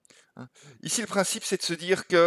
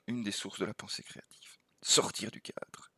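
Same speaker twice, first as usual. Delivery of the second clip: hushed whisper, softer; whispered key point; 4 semitones lower, 8 dB quieter than usual. Real, not acted. Teacher to students on creative thinking, hushed whisper. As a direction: breathe the key idea like a secret.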